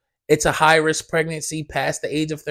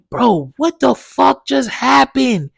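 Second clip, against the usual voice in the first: shocked disbelief; 7 semitones higher, slower than usual. Retold or imitated. Shocked reaction in a dream.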